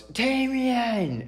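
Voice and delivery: high, loud call of his name; mom yelling. Retold or imitated. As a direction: yell the name from across the house. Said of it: "my mom yells".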